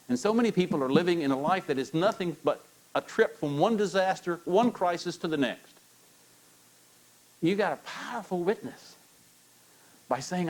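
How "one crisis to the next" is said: ordinary delivery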